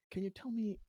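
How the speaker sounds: ordinary delivery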